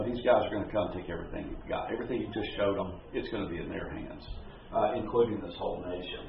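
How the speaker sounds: low, soft and fast; grave warning. Retold or imitated. Isaiah's grim prophecy retold.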